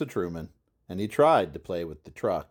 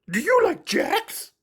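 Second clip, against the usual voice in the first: high, slow monster voice; high puppet voice, hopeful; hopeful Grover; 4 dB louder than usual. Acted. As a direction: ask hopefully.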